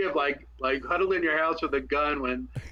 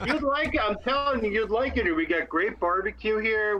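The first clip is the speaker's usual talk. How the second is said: eager, higher pitched pitch; excited urging; 6 semitones higher than usual. Real, not acted. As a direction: sell it with eager enthusiasm. Urging friend to move.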